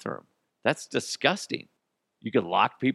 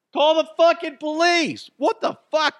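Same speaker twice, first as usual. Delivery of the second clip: much higher, louder outraged shout; shocked yell; 7 dB louder than usual. Real, not acted. Reacting to a listener locked in a boyfriend's trailer, shrieking in alarm. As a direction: shriek at the absurd trailer lockup.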